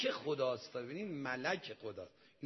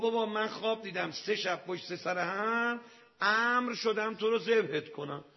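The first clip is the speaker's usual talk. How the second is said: raised, loud voice of a father declaring a command; forceful proclamation; 7 semitones higher, 5 dB louder than usual. Acted. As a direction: declare it urgently to the son. Acted father announcing God's command. (اعلام بلند و پرشور)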